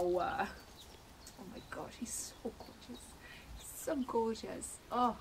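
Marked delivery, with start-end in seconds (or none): 0.0-1.9 slow awed whisper. Real, not acted. Slow breathy awe at plush. 2.0-3.0 soft whisper of delight; hushed real awe; whispered admiration. Real, not acted. Whispered then said aloud.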